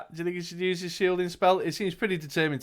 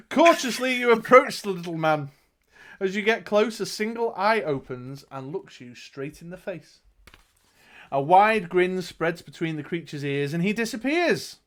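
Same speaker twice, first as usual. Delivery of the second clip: dramatic storyteller, key words punched; game master narrating the action; gamebook narration; 3 semitones higher, 4 dB louder than usual. A narrator's reading. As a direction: read it out like an adventure tale. Reads gamebook aloud to player.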